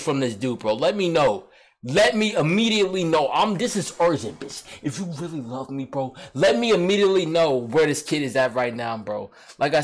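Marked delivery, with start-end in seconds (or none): none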